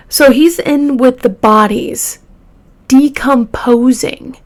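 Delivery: usual speaking voice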